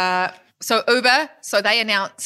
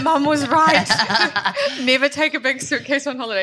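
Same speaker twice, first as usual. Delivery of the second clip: laughing admission; 8 semitones higher than usual. Retold or imitated. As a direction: laugh as you admit she was right. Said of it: "It's like".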